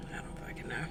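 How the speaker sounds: a frustrated whispered aside; whispered curse. Real, not acted. Frustrated with herself, whispering a curse. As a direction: whisper the curse under your breath.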